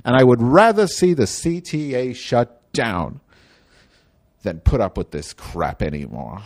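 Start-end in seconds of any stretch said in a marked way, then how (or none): none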